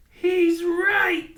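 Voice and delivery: high prairie dog voice. A narrator's reading. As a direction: agree kindly. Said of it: "said the prairie dog".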